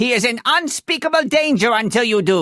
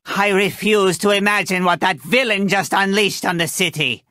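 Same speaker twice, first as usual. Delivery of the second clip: normal talking voice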